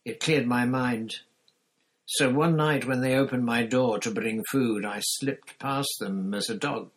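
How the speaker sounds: a hint of a driven ex-prisoner, rising intensity; Sirius recounting.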